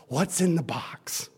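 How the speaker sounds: slow unvoiced whisper. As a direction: whisper it like a secret. Girl whispers her question.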